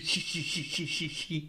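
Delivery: teasing "shi-shi-shi" cackle; hissed, rhythmic cartoon laugh; snickering through the teeth in quick bursts; hissing snicker. A narrator's reading. Kid's teasing laugh after gloating.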